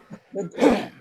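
sharp throat clear. Real, not acted. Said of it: "I'm coughing".

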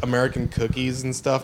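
usual speaking voice

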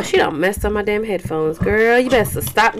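usual speaking voice

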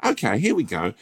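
nervous posh newbie. Pretend. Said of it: "And you're like".

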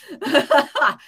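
a burst of real laughter; loud laugh. Real, not acted. Telling friends the story, bursting out laughing.

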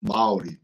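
Maori with a quick rolled R; native Maori pronunciation. Shown as a demonstration. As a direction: demonstrate Maori, tapping the R lightly. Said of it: "how do you pronounce it".

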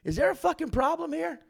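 high loud callout to a heckler; imitating Peanut yelling at a fan; angry mic rant. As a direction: scold him hard then flip it to love. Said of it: "he's like".

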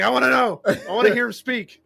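high, loud mock-eager outburst; excited eagerness. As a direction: blurt it out, high and insistent. Acting out obsession with case.

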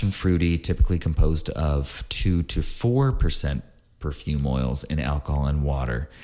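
normal talking voice

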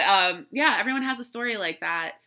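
usual speaking voice

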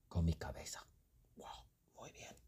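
breathy secret whisper. Acted. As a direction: lean in and whisper it. Dramatic hushed story moment.

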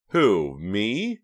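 wide-eyed feigned innocence; exaggerated innocence. A narrator's reading. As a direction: play innocent, overdone. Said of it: "with exaggerated innocence".

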